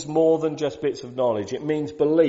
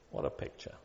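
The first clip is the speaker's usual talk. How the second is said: hushed; 13 dB quieter, faster than usual. Real, not acted. Awed hush after the quote.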